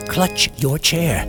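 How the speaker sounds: ordinary delivery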